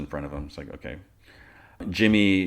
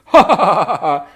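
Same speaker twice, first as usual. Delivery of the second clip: loud, high hammed-up cackle; boisterous mock laugh; 13 semitones higher, 13 dB louder than usual. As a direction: imitate a showy, boisterous laugh. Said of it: "She laughs, like, boisterously".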